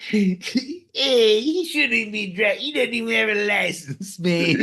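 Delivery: mock heckler. Rowdy fan jeering at [name], high voice.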